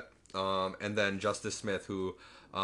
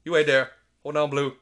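louder firm trainer commands; a stern louder trainer's call; commanding call; 5 semitones higher, 11 dB louder than usual. Retold or imitated. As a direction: order the raptor to stay. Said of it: "he's just like".

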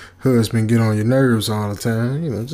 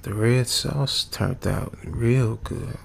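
mumbling sleepy wife; 5 dB quieter, slower than usual. Retold or imitated. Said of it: "Then she said".

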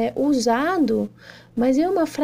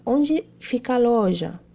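normal talking voice

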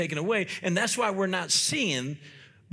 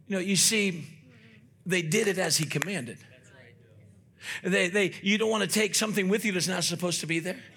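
ordinary delivery

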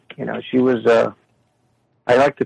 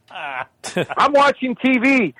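high, drawn-out mock mother; rambling old mother; 8 semitones higher, much slower than usual. Mother breaking off into a new topic, high complaining voice. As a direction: imitate a mother changing the subject, slowly. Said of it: "she'll just break off into something".

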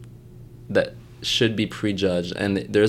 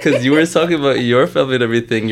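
laughing; 6 semitones higher, 7 dB louder, faster than usual. Real, not acted. Laughing while asking host.

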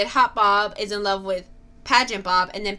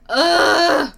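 disgusted ugh; 6 semitones higher, 7 dB louder than usual. Retold or imitated. Imitating disgust sound.

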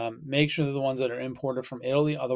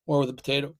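a burp breaking into the words; faster than usual. Real, not acted. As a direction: talk through a burp. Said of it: "Can't stop burping today".

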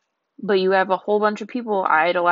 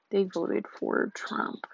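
ordinary delivery

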